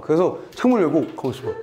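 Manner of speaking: normal talking voice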